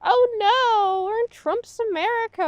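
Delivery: high, whiny put-on panic; a hammed-up wail of alarm; mock dismayed viewer. Mocking a viewer who overreacts, in high fake panic. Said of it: "you can be like".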